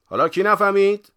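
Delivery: high, very loud question; loud schoolmaster challenge; teacher quizzing class. A narrator's reading. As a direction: demand an answer from the class. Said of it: "صبر کرد و پرسید".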